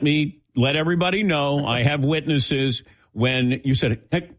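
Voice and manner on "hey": higher, hurried mock panic; lightly panicked impression of a colleague. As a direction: imitate a worried colleague asking quickly. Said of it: "say it in a panicked voice".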